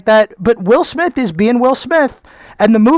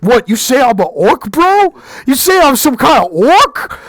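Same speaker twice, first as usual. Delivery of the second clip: indignant "bro", pitched up; offended guy voice; 3 semitones higher than usual. Retold or imitated. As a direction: play an offended online guy. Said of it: "a splotchy skin person is like".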